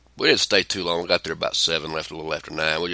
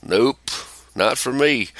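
ordinary talk

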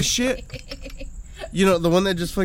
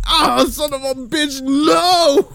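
high, slow, louder whine; panicked wail; 8 semitones higher, 4 dB louder, much slower than usual. Retold or imitated. On the ride going down, high slow wail. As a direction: moan it as the ride drops. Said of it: "I'm like".

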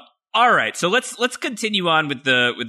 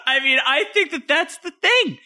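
laughing; slower than usual. Real, not acted.

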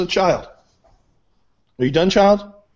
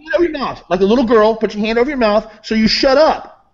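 angry scolding; 3 semitones higher than usual. Real, not acted. Guest silencing the man who interrupted him, angry scolding.

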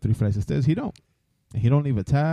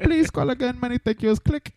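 much higher mock Indian call-centre agent; mock call-centre accent; 13 semitones higher than usual. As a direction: hang up sweetly in the mock agent voice. Mocking agent hanging up.

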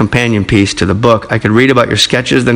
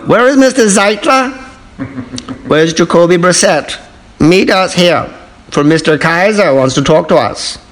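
lightly done mock German accent, raised pitch; a hint of a cartoon German officer accent; 5 semitones higher than usual. Host joking about the Browns, mock German accent. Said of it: "Nazi accent".

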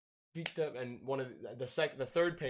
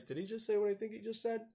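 shocked disbelief; 9 semitones higher than usual. Retold or imitated. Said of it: "the old painters".

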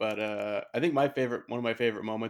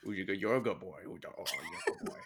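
put-on dog baby talk; 2 semitones lower, 5 dB quieter, much faster than usual. Cooing at an imagined dog.

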